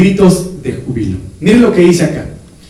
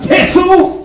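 grito del ciego; 11 semitones higher than usual. Retold or imitated. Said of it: "Como aquel ciego".

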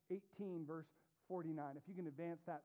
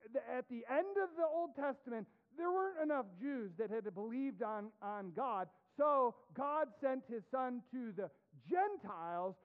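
impassioned preaching; 4 semitones higher, 7 dB louder than usual. Acted. Preaching at full force.

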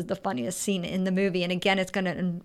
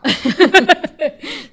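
laugh; 8 semitones higher, 11 dB louder than usual. Real, not acted. Laughing at funny fact.

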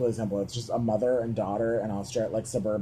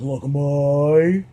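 loud, drawn-out Aussie "look at moooy"; insistent Australian mom, stretched and rising; 2 semitones higher, 9 dB louder, much slower than usual. Retold or imitated. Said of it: "Your Australian accent is good".

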